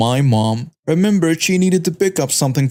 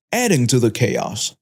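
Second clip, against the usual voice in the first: ordinary delivery, nothing marked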